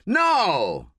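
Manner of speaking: high alarmed shout. A narrator's reading. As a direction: yell to stop the gunman. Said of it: "shouts Jackson".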